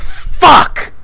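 high-pitched, strained mock yell; retold yell. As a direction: imitate a sudden cry of dismay. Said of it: "just yells".

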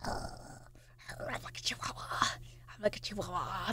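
breathy whisper, barely voiced. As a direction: hush it like a secret. Whispered repeated line.

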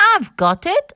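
high-pitched burst of a bright idea; mild, eager story shout; excited shout. Acted. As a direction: call out a sudden idea. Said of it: "shouts Ted".

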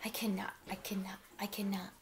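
whispered rhythmic refrain; tired engine whispering to itself; whispered chugging. A narrator's reading. Old engine chugs away muttering.

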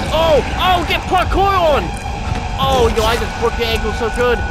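loud, high whoop easing into bragging; triumphant crowing. Pretend. Player brags after outrunning the villain, triumphant crowing. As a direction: gloat loudly over the beaten foe. Gloating after escaping enemy.

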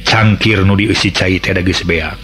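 plain reading voice

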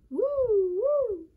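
high, loud two-note siren whoop; imitated siren wail. A narrator's reading. Sam plays a racing firefighter, imitated siren wail. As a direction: wail like a fire engine. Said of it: "trying to sound like a siren".

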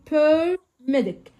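Paramedic drawn out slowly, stressed on the third syllable; slow drilled paramedic. Shown as a demonstration. Teacher answering the quiz prompt with a slow, clear Paramedic. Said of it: "اسمهم إيه؟".

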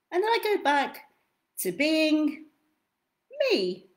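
lively storybook read with big swings; reading to children. Acted. Reads picture book aloud.

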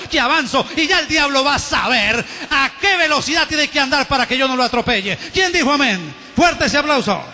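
loud, driving sermon voice with rising fervor; full-force preaching. Acted. Pastor rousing the church to shout amen, loud fervent preaching. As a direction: preach it out to the whole congregation.

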